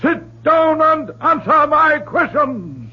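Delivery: exorcist's forceful challenge; stern command. Acted. Reverend confronts demon.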